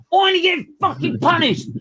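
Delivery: high, loud outraged yell; retold furious shout. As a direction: yell at the stewards like you've lost it. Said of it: "lose my shit".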